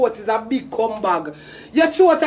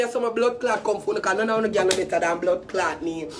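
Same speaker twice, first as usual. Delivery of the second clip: normal talking voice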